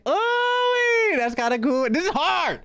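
high, squealing delight; excited squeal. Real, not acted. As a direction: squeal with joy. Squeal at design.

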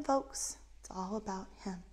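ordinary delivery

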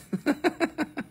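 short high breathy laugh; a quick squeaky giggle. Real, not acted. Laughs after own impression.

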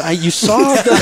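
loud, high comic outburst; mock indignant protest. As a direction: protest at the top of your voice, hammed up. Mock protest at mother's crying.

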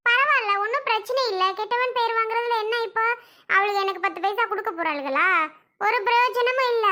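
high-pitched, dismissive comeback; defiant retort. Acted. Scolded woman answers back about her bad name, defiant.

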